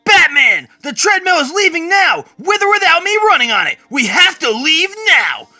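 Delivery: shouted narrated dialogue, pitched well up; urgent yell. A narrator's reading. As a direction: call out urgently to hurry someone along. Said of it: "Barry yells out".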